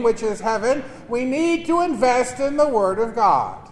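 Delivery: ordinary delivery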